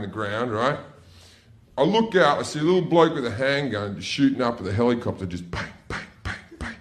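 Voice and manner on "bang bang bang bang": tiny, soft voiceless pops; hushed gun noises; imitated distant gunshots. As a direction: mimic faraway little pistol shots. Imitates distant handgun shots.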